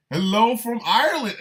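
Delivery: high, loud greeting; shout-out. Real, not acted. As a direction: call out to a far-off fan. Shout-out to a listener abroad.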